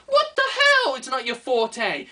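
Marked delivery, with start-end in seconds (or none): 0.1-0.9 exasperated outburst. Real, not acted. Outburst at the excuse.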